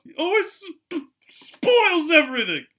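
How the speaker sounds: high, loud, slow whiny wail; yelling fake sobs. A narrator's reading. Spoiled boy fake-crying to his mother, high, loud wail. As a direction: bawl it out like a spoiled child faking tears. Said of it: "Dudley yelled between huge pretend sobs".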